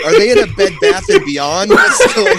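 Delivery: high squeaky laughing voice; squeaky rat voice. Acted. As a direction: squeak out the question, giggling. Mock squeaky reaction, laughing.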